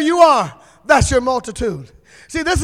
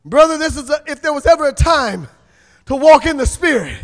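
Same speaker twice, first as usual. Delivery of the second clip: usual speaking voice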